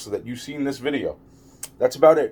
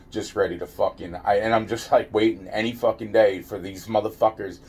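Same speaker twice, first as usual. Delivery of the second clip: ordinary delivery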